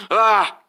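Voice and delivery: short loud angry shout; frustrated yell. Acted. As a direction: let out a burst of frustration. Frustrated outburst.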